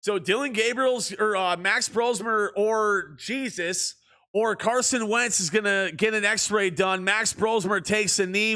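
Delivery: lively play-by-play call; sportscaster delivery; broadcaster voice. Acted. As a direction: call the game like a TV announcer. Live play-by-play commentary.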